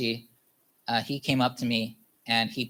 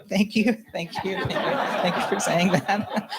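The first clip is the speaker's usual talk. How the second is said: higher, giggling thank yous; laughing thanks; 6 semitones higher than usual. Retold or imitated. Thanking his sister for her anger, laughing.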